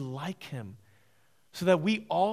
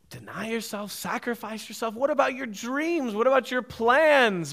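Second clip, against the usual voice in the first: voicing Satan; 7 semitones higher, 9 dB louder than usual. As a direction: tempt the listener, building to outrage. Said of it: "He says".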